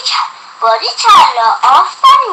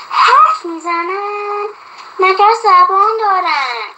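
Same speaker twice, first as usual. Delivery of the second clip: slow, higher amazed question; surprised; 6 semitones higher, slower than usual. A narrator's reading. Girl to mother about talking clothes, amazed and higher. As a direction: marvel at the idea that clothes talk. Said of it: "مهدی با تعجب".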